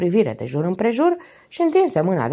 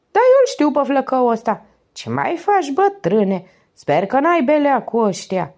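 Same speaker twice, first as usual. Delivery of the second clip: higher, louder cheeky vagabond voice; street loafer Toto; 8 semitones higher, 4 dB louder than usual. A narrator's reading. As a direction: greet him like an old pal from the market. (vagabond cheeky vorbind mai sus și mai tare)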